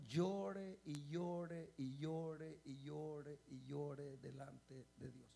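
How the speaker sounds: slow, low lament for the weeping king; drawn-out lament. Acted. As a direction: drag out each llore mournfully.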